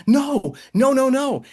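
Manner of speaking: high, loud, insistent no's; mock-panicked shouting no; frantic denial. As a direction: protest wildly that it is true. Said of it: "I was like".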